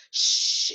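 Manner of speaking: unvoiced "sh" held long on its own; isolated sh sound. Shown as a demonstration. Teacher showing the digraph's phoneme, isolated sh sound. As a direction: say "sh" as one drawn-out hushing sound. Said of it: "it's in that last box there".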